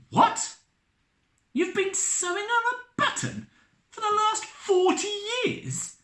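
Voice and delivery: high astonished disbelief. Acted. Captain astonished at Elizabeth's excuse, high. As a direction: sound utterly amazed. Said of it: "in astonishment".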